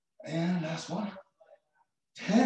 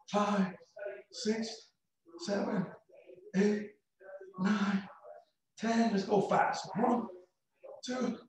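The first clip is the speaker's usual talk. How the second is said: counting reps; 5 semitones higher, 4 dB quieter, much slower than usual. Acted.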